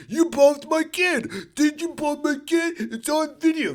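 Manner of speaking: high, pushy, accusing voice; confrontational dad. Retold or imitated. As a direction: get in his face. Parent filming, accusing.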